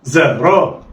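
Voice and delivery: زا را snatched together in one go; blended quickly. Shown as a demonstration. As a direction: run زا and را together. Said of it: "يلا، اخطف".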